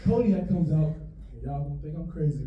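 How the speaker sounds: usual speaking voice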